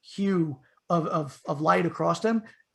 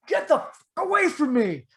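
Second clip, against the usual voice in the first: higher, loud defiant shout; mustered courage; 9 semitones higher, 5 dB louder than usual. Retold or imitated. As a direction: show it you're tough. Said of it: "mustered up enough courage".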